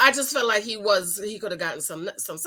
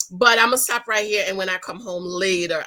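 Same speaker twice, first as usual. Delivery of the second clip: ordinary delivery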